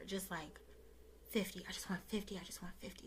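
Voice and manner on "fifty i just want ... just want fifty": hushed, quick whispered plea; whispered prayer. Retold or imitated. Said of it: "And that was my prayer".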